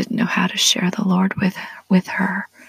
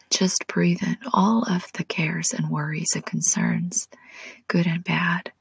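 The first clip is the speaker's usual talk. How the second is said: slow, soothing meditation whisper; soft spa whisper; 3 dB quieter than usual. Acted. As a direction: guide them to relax, whispering. Leads a relaxation prayer.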